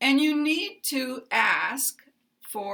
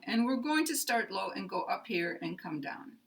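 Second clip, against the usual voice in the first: ordinary talk, nothing marked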